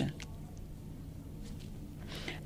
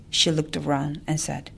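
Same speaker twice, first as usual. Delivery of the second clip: usual speaking voice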